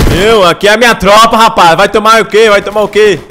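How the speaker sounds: high, loud gang-boss bragging; loud taunting. Pretend. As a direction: shout down the enemy in mock menace. Boasting and taunting in gunfight.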